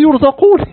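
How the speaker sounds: high loud yurzaqun; emphatic answer. Acted. Acted answer in dialogue.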